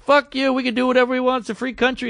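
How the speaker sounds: high-pitched, mock-angry comeback; defiant retort. Girls talking back to the man on the bus, defiant. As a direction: imitate girls mouthing off.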